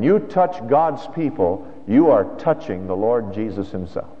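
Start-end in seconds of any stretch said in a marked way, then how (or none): none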